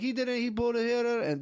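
high-pitched mock Jamaican complaining; garbled high voice of protesting old guys; imitated old Jamaican guys. Old Jamaican guys at the tow yard, imitated protesting. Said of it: "they're just these old guys".

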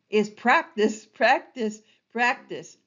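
each "practice" stressed, falling, fading; emphatic repetition. Shown as a demonstration. Teacher drilling the key rule, emphatic. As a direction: hammer "practice" three times. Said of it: "emphatic repetition".

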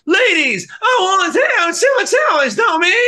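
hammed-up frontman yelling at the ladies. Rock singer addressing the crowd from the stage, hammed-up shout. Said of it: "if [name] had been on stage".

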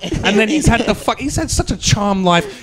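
Bill Burr Boston impression. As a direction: riff like an incredulous Boston stand-up. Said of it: "That was my Bill Burr".

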